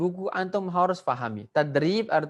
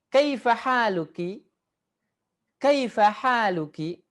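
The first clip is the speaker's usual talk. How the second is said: higher, slow and louder question; model reading; 5 semitones higher, 3 dB louder than usual. Shown as a demonstration. Teacher reading the Arabic question for the class to copy, slow and loud. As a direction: sing it out slowly for repeating.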